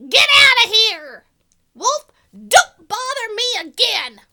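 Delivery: loud high angry yell; rude shout. Acted. Rude pig yelling at the wolf to go away. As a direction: bark the pig off the doorstep. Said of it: "what that rude little porker answered".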